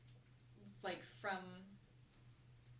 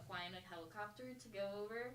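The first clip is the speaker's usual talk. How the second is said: ordinary talk, nothing marked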